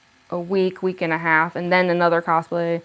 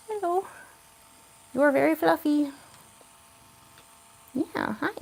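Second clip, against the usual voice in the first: talking to a cat; 7 semitones higher, 4 dB quieter than usual. Real, not acted. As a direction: coo quietly at the pet. Greeting the cat on camera.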